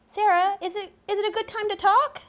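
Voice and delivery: bright, high and loud polite caller; model phone voice. Shown as a demonstration. Therapist demonstrating a polite phone greeting, bright and high. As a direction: show him how a friendly caller asks.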